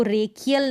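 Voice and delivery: "रेकियल" with a hard k mid-word; wrong k pronunciation. Shown as a demonstration. Teacher shows how not to read racial, wrong k pronunciation. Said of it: "नहीं पढ़ेंगे".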